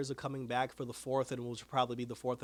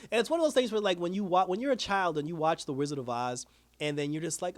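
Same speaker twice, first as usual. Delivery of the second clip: normal talking voice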